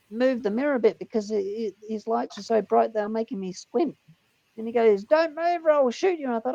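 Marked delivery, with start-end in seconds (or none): 5.0-6.3 high-pitched retold cop command; mock police warning. Cop repeats his threat to driver, high mock command. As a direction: call out the threat like an officer.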